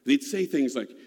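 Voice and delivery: ordinary talk, nothing marked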